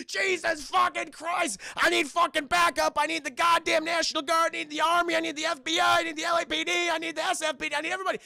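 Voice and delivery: high, loud, desperate calls for backup; frantic shouting. Retold or imitated. Pinned-down cop screams for backup, frantic. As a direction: yell every order like the world is ending. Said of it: "Just like".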